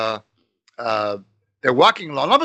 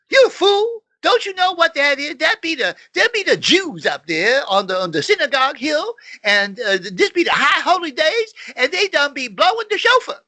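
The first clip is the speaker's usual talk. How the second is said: hammed-up dialect, much higher; mock dialect voice; 11 semitones higher than usual. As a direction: scold the other man knowingly. Homeless man explains the shofar.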